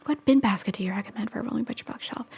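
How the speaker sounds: quick whisper reading out the question; reading chat under your breath. Real, not acted. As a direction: murmur the question to yourself.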